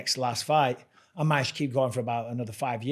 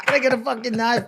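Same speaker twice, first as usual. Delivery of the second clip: laughing imitation; 10 semitones higher, 6 dB louder, faster than usual.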